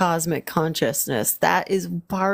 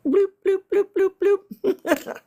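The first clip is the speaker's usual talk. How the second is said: playful bloops; 12 semitones higher than usual. Pretend. Playful sound while sending drawing.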